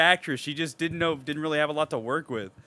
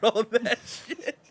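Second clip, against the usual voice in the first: laughing; 10 semitones higher, slower than usual. Retold or imitated. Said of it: "He's like".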